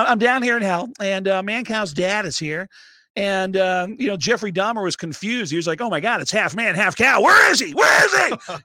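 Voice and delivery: hammed-up Kinison shriek building to a scream; Sam Kinison impression. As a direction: yell it like a comic raging from hell. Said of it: "as Sam Kinison".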